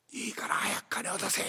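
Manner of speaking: whispered instead of shouted; timid whisper. Acted. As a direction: lose your nerve and whisper it. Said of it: "だらしがねえ野郎だな".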